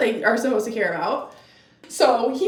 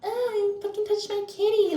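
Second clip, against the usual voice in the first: mock giddy girl; 10 semitones higher than usual. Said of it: "And like".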